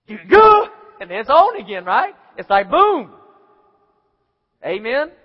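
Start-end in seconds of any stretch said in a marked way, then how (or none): none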